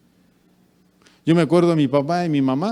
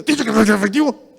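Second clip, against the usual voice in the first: fast, loud, higher rude snap; 1 cliente grosero; 9 semitones higher, 8 dB louder, much faster than usual. Retold or imitated. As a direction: snap back irritated.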